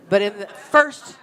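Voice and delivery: usual speaking voice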